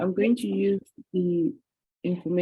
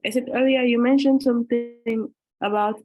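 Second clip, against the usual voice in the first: louder and higher, repeating clearly; speaking up; 5 semitones higher, 5 dB louder than usual. Shown as a demonstration. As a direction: raise your voice so she hears. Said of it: "Could you speak up".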